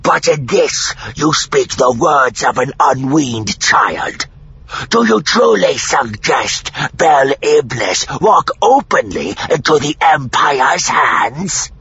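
loud, high, rough alien voice; slow, forceful alien with a challenging edge. A narrator's reading. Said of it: "his voice on the edge of challenging".

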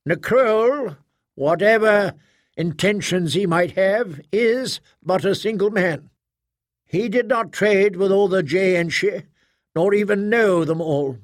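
proctor holding forth. Acted.

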